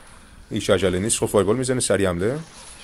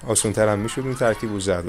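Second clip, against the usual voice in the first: ordinary talk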